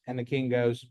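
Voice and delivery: ordinary delivery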